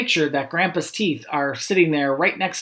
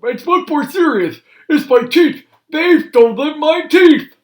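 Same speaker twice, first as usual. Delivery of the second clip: high, loud, slow lament; distraught Grandpa; 12 semitones higher, 9 dB louder than usual. Acted. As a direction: wail like a toothless grandpa in despair.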